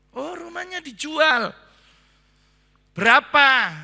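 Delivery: a high, loud, eager buyer asking the price. Retold or imitated. Buyer hearing the house is sold, asking eagerly. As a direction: pounce on the house like a keen buyer.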